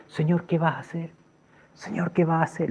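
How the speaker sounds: very soft, pensive plea; hushed inward prayer. Retold or imitated. Man lying on the grass asking the Lord, hushed. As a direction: murmur a private question to God.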